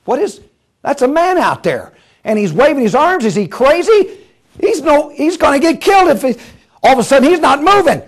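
high, mounting alarm; alarmed engineer. Acted. Engineer seeing a man on the track, rising alarm. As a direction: build from puzzled to panicked.

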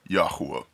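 Yahuwah said "yah-HOO-uh"; speaker's own pronunciation. Shown as a demonstration. Giving his own preferred form, Yahuwah as yah-HOO-uh. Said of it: "I say".

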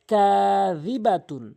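slow drawn tilawat of কাযিবাতুন; Quran recitation. Shown as a demonstration. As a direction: chant it slowly, full nunation. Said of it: "তা দুই পেশ তুন".

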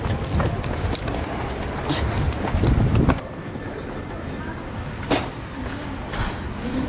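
unvoiced panting laugh; breathless laugh. Real, not acted. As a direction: laugh while catching breath. Breathless after running.

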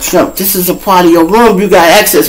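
lightly voiced cop insisting, pitched high. Cop insisting in retold argument.

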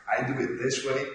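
usual speaking voice

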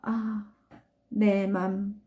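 usual speaking voice

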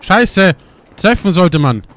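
loud curse; frustrated shout. Real, not acted. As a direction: shout in anger at missing. Frustrated at missing. (lauter Fluch über den Fehlschuss)

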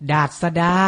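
ดาษดา said carefully as dat-sa-da; careful teaching read of ดาษดา; pronunciation model. Shown as a demonstration. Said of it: "อ่านว่า".

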